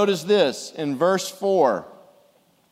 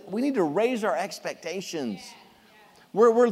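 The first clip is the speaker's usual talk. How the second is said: ordinary talk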